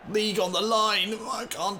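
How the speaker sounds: high, loud anguished call; desperate cry. Real, not acted. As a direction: cry it out in despair. Desperate at losing.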